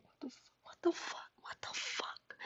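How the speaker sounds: stunned whisper to oneself; barely voiced, hushed disbelief; shocked. Inner reaction after allowing the hug, shocked whisper. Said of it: "I'm over here shocked as a motherfucker".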